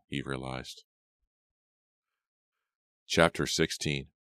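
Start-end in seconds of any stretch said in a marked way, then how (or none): none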